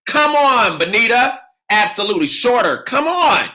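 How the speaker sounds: fired-up teacher cheering the room on; excited cheering. Real, not acted. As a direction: egg the crowd on as they answer. Hyping up students naming colleges.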